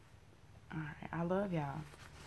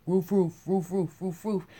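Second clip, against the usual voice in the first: barking dog. Retold or imitated. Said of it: "pets are people too".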